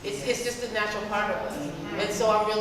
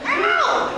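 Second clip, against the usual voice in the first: mock yelp; 7 dB louder than usual. Reacting to young filmmakers.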